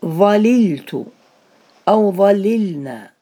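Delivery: ظللت drawn out very slowly, each letter spelled clearly; teacher lingering on each syllable of ظللت; slow demonstration. Shown as a demonstration. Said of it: "فتقول".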